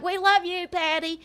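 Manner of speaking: high, sing-song mock adoration; mock fawning fans. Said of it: "They're like".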